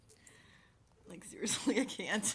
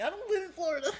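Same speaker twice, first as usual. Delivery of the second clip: high defensive protest; 9 semitones higher, 4 dB louder than usual. Retold or imitated. Mock-defending herself against the flamingo teasing, pitched up. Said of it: "I was like".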